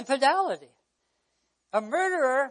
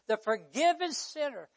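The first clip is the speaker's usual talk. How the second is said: ordinary delivery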